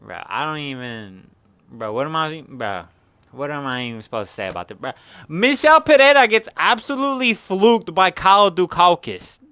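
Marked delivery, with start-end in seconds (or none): 5.3-9.4 high, loud announcer shout; loud hype call. Real, not acted. Hyped announcement.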